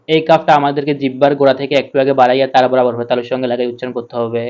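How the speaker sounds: ordinary talk, nothing marked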